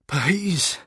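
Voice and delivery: high, loud frightened plea for mercy; a beaten man pleading at gunpoint; Stokes begging. A narrator's reading. Beaten man begs the gunman, high and frightened. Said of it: "the guy said".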